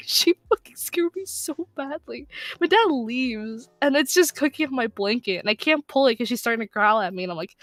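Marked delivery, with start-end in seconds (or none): 0.1-2.3 high, slow mock whimper; mock tearful whine. Scared teller excuses screaming to dad, mock tearful whine.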